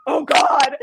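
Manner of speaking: re-enacted horrified "oh God"; horrified outburst. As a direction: act out the shock of what you did. Acting out her horror after slapping.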